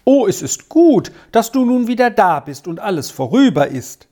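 light up with gladness, pitched high; delighted. A narrator's reading. Squirrel welcomes Arthur back, delighted. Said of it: "sagte Squirrel erfreut".

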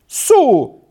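high, loud indignant exclamation. A narrator's reading. Boy stung by his friend's taunt, indignant outburst. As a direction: burst out, offended. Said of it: "rief Peppino entrüstet aus".

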